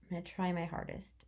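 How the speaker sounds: ordinary talk, nothing marked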